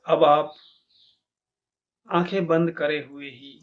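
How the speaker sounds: measured meditation guide, long pauses between instructions; guided meditation. Acted. Teacher leading listeners to feel each body part, calm meditation guidance.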